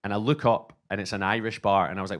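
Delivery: ordinary talk, nothing marked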